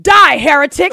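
loud furious shout. A narrator's reading. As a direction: yell it at the heretic. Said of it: "the second man shouted".

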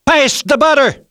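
put-on loud, high shout of a small child; sudden high-pitched demand; blurted out. A narrator's reading. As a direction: blurt it at full volume across the table. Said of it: "blurted out".

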